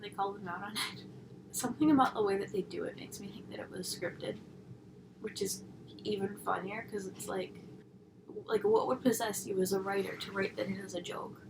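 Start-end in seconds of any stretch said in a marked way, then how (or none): none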